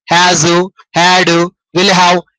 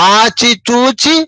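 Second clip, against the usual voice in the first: slow and deliberate; much slower than usual. Shown as a demonstration. Teacher stressing careful speech.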